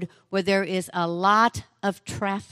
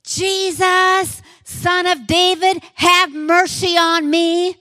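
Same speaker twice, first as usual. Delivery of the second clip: full-voiced cry, much higher and louder; desperate shout; 10 semitones higher, 6 dB louder, slower than usual. Blind beggar shouting to Jesus from roadside, desperate loud cry. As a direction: cry out for mercy with everything. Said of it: "And so he shouts".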